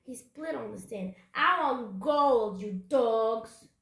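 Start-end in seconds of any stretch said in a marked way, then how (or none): 1.3-3.7 loud, slow pirate demand; drawn-out, loud pirate bluster; growling pirate captain. A narrator's reading. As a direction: bellow greedily at your crew. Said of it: "growled Captain Bones".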